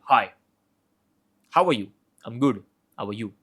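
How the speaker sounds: low, flat, no-nonsense greeting; authoritative tone. Shown as a demonstration. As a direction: greet them like you mean business. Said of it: "the second one is authoritative".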